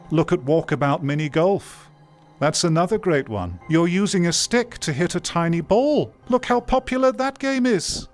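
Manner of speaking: high-pitched, enthusiastic argument; excited persuasion. Real, not acted. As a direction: pitch climbing as the point builds. Enthusing over simple successful games.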